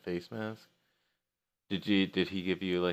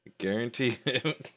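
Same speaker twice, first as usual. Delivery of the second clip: acted reply; 6 semitones higher than usual. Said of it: "And I'm like".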